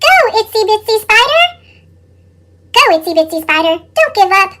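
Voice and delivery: cheering shout. Acted. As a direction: cheer the spider on. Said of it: "Pete and his friends shouted".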